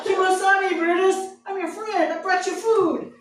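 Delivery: high, loud, laughing plea to a snarling dog; frightened plea to a snarling dog; nervous pleading. Retold or imitated. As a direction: beg the dog to be friends, half panicked.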